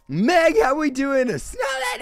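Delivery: high, loud, excited greeting; excited shout-out. Real, not acted. Streamer greeting chat viewers with a loud, excited shout. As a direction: call out their name with real excitement.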